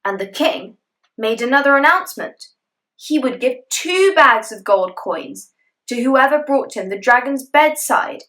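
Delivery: usual speaking voice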